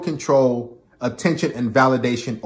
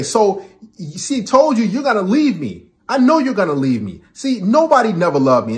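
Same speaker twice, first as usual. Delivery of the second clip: high, put-on self-pitying whine; mock whiny girlfriend; 6 semitones higher than usual. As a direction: play the clingy victim. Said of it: "they'll say".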